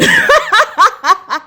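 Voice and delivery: high burst of laughter; real laugh. Real, not acted. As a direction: crack up in disbelief. Laugh after neighbor quote.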